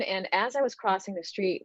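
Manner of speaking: usual speaking voice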